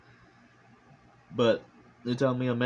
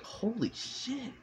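awed disbelief; 12 semitones higher, 7 dB quieter, slower than usual. Retold or imitated. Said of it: "Like, it was like".

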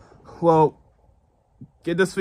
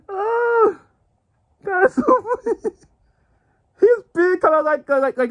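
laughing; 4 dB louder than usual. Real, not acted. Still laughing.

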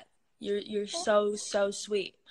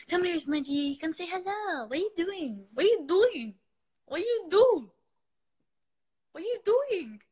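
high swooping coo to a dog; pet talk; 8 semitones higher, 4 dB louder than usual. Real, not acted. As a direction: coo playfully at your dog, pitch looping up and down. Cooing to dog Smudge.

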